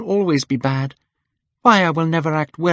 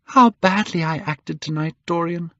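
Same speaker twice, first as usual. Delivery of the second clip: cried out; 8 semitones higher than usual. A narrator's reading. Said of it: "she cried".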